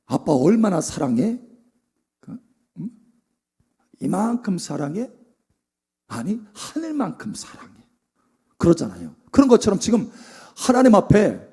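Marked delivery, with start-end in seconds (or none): 0.1-7.7 put-on tender child talk. Parent and child love exchange.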